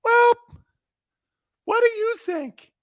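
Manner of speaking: timid falsetto radio voice, full of ums; very high, tentative caricature; high-pitched West Coast host. Shown as a demonstration. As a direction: squeak out hesitant questions as a mock West Coast host. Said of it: "I'm talking in that high-pitched voice".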